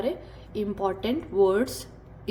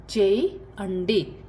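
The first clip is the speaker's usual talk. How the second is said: चेयंडी stretched out very slowly; very slow repeat; much slower than usual. Shown as a demonstration. Teacher repeats Telugu for do very slowly. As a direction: drag चेयंडी out for learners. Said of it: "कुछ करना है".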